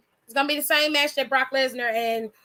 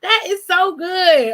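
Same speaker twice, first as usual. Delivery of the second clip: louder and higher, slow and proud; delighted; 4 semitones higher, 7 dB louder, slower than usual. Real, not acted. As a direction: savour the win out loud. Cheering team.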